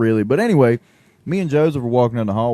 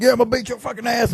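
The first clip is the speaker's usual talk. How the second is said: much higher mock threat from a meathead; mock tough guy; 13 semitones higher, faster than usual. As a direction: ham up a big guy's threat.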